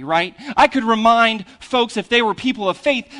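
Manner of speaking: normal talking voice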